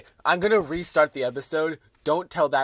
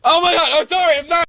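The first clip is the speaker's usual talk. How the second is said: high, loud apologetic outburst; frantic apology; 13 semitones higher, 10 dB louder, faster than usual. Real, not acted. Host apologizing to co-host, high frantic shout.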